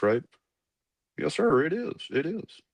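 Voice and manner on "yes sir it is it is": put-on Colonel Waldo's thick Arkansas accent. Colonel calls the cadet into his office, thick Southern accent. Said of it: "really thick Southern Arkansas accent".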